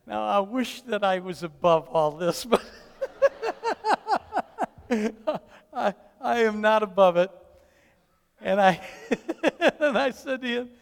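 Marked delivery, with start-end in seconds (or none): 3.0-5.4 long, high cackling laugh; real high giggling fit; sheepish laugh. Real, not acted. Laughs at his own pettiness. 8.8-9.7 high, loud giggle; real high chuckle; amused laugh. Real, not acted. Laughs while confessing.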